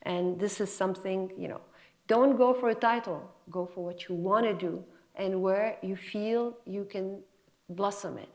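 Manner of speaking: ordinary talk, nothing marked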